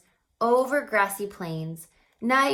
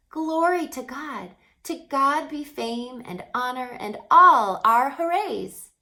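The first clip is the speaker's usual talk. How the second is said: high, louder ringing proclamation; joyful praise; 5 semitones higher, 7 dB louder than usual. A narrator's reading. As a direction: call it out like an angel choir. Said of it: "they were singing a beautiful song".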